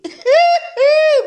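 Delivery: a big high burst of laughter; loud laugh. Real, not acted. Creator laughing aloud at the rival's letter. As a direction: crack up at the letter.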